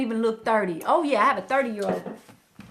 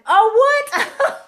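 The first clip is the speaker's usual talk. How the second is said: mock surprise; 12 semitones higher, 9 dB louder than usual. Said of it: "I'm like".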